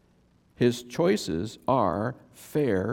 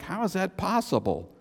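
puzzled Israelites; 4 semitones higher than usual. Retold or imitated. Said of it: "They were like".

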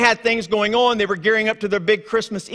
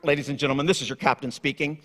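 lower, softer captain's announcement voice; airline captain; 6 semitones lower, 6 dB quieter, faster than usual. Retold or imitated. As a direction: sound like a pilot on the intercom. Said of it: "when the captain goes".

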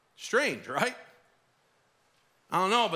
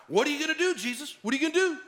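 high, needling mock taunt; taunting challenge; 8 semitones higher, much faster than usual. Religious leaders goading Jesus to answer, high mocking taunt. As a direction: goad him with a higher, cocky jab.

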